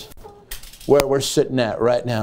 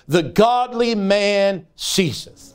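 slow tragic reading; 4 semitones higher, much slower than usual. Shown as a demonstration. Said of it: "what a tragic thing to hear".

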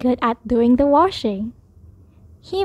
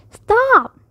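high cry to halt; shouted order; 8 semitones higher, 4 dB louder than usual. A narrator's reading. Child halting Bear's snow in the bedroom, shouting. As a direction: call it out high, falling at the end.

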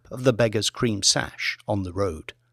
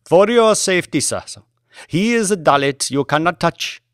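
put-on higher, quick and apologetic, Indian-accented driver; a driver anxiously excusing himself to his passenger, Indian accent; 6 semitones higher, 4 dB louder than usual. Driver explains the central locking.

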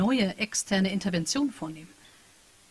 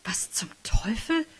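stunned whisper; 2 semitones higher, 4 dB quieter, slower than usual. Acted. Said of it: "fragte Patricia laut".

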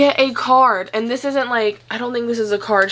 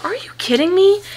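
delighted disbelief; 10 semitones higher than usual. Real, not acted.

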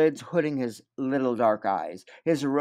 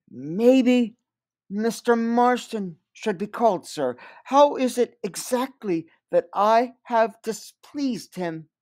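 higher, slow, softened request; voice going up; 10 semitones higher, 6 dB louder, slower than usual. Acted. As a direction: lift the voice to soften it. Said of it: "Her voice went up to soften the request".

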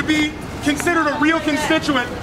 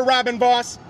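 ordinary talk, nothing marked

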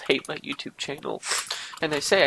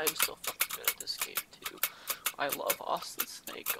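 whispered; 13 dB quieter than usual. Acted. Fan whispering love for Austin Snake. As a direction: whisper it like a confession.

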